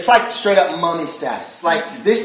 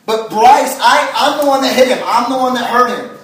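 higher, louder heartfelt confession; emphatic preaching; 4 semitones higher, 5 dB louder than usual. Real, not acted. Pastor confessing to the crowd he hurt his son, emphatic. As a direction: press the point with rising passion. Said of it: "emphatic preaching".

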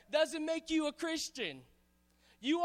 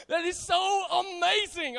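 laughing; 4 semitones higher, 5 dB louder than usual. Real, not acted. Laughing about sweet tea.

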